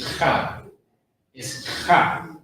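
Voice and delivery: "ha" with a strong, airy rasped H; H put in. Shown as a demonstration. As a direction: let the breath scrape at the start of "ha". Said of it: "you gotta put in the H in there as well".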